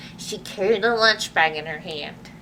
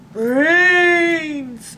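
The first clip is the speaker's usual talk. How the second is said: long, louder zombie groan; zombie moan; 6 semitones higher, 6 dB louder than usual. Acted. The zombies reach for the sandwich, moaning long and louder. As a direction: drag out the moan like a zombie crowd. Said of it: "moaned the zombies".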